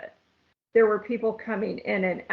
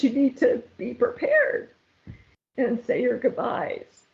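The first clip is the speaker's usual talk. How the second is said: getting teary; 6 semitones higher than usual. Real, not acted. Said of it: "I'm gonna get teary-eyed".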